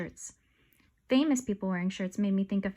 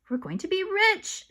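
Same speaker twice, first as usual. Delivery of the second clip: excited shout; 8 semitones higher than usual. Acted. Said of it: "shouted Alexia".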